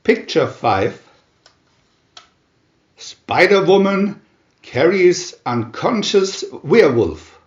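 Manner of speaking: ordinary delivery, nothing marked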